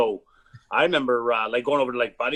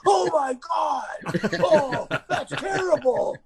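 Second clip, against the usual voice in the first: shocked outcry; 13 semitones higher, much slower than usual. Real, not acted. Reacting to fight clip.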